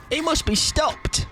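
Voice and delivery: high, slow melodramatic line; dramatic declaration. Pretend. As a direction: declare it like a hammy hero. Mock dramatic hero declaration.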